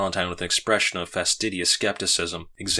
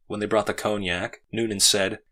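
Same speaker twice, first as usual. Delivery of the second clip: plain reading voice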